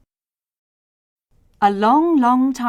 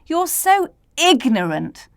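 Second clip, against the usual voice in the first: condescending; 7 semitones higher, 4 dB louder than usual. A narrator's reading. Know-it-all scientist scolds.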